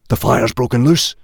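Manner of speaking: sudden fear for the cave; alarmed caveman. A narrator's reading. Hal realising the cave is on fire, alarmed.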